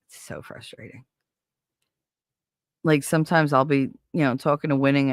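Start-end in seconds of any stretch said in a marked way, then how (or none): 0.1-1.0 near-whispered frustration, much softer; quiet frustrated murmur. Real, not acted. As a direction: let it sink into a tired near-whisper.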